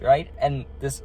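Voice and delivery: ordinary talk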